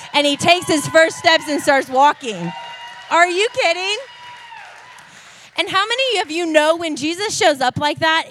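loud, high preaching climax; triumphant shout. Real, not acted. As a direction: shout the victory to the crowd. Climax: man walks after fifteen years.

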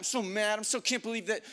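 high, agitated rant; worked up and lost for words; angry venting. Retold or imitated. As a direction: spill out exasperation in a tumbling rush of words. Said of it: "Eventually the anger went".

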